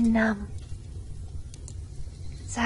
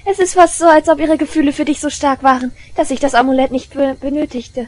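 much higher and louder, unhinged glee; manic gloating; 4 semitones higher, 11 dB louder than usual. Acted. Girl mocks rival's love to listener, manic and loud. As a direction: ride the mania straight out of the laugh.

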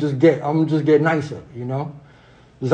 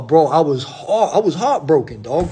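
normal talking voice

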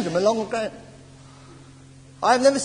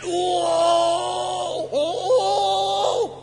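imitated travail wail; 9 semitones higher than usual. Preacher imitating people on the floor in fetal position, high wail. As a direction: wail like someone labouring on the floor. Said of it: "They're going".

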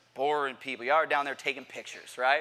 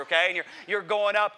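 ordinary talk

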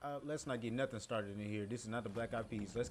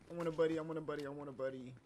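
high, insistent repeated plea; whiny chant, starting loud then easing; pleading; 4 semitones higher, 5 dB louder, faster than usual. Pretend. Player alone in the game wanting company, pleading.

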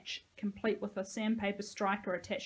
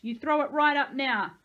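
raised, high-pitched order; shrieked; 5 semitones higher, 8 dB louder than usual. A narrator's reading. Boy orders them to throw it up, shrieked. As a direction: demand it at a shout. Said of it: "he shrieked".

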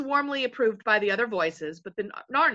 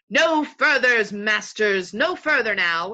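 loud, slow warning to halt; chief invisible voice; 7 dB louder, slower than usual. A narrator's reading. Invisible chief halting strangers on the beach, commanding. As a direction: call out and stop them in their tracks.

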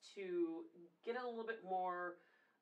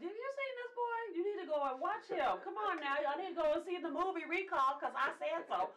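much higher and louder, hyping everyone up; hyped-up cheerleading; 12 semitones higher, 9 dB louder than usual. Retold or imitated. Telling everybody to watch his movie, excited and much louder. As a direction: rave about him like his biggest fan. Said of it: "I'm like".

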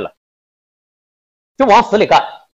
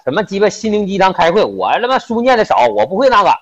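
higher, pushy rallying of the team; hyped-up pep talk; 6 semitones higher than usual. Retold or imitated. Ringleader hyping up his coworkers, raised and eager. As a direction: pump the crew up like a cocky ringleader. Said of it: "这山河就在那呜呜喳喳来了".

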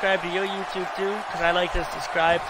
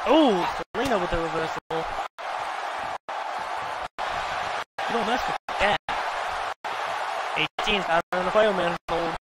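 excited live play-by-play calls; play-by-play commentary. Acted. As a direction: call the match like a ringside commentator.

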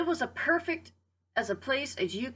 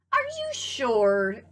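slow, drawn-out skeptical question; doubtful; 2 semitones higher, 3 dB louder, much slower than usual. Acted. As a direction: stretch it out, unconvinced. Doubting turkey questions hen.

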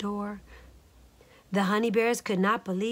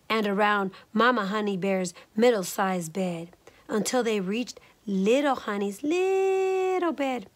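usual speaking voice

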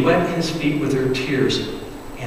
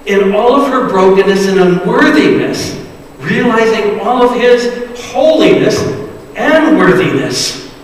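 louder, higher preaching swell; preacher lifting the congregation; rising fervour; 6 semitones higher, 6 dB louder than usual. Acted. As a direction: build to a fervent peak. Climactic praise of woman.

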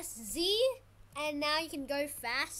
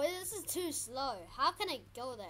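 ordinary talk, nothing marked

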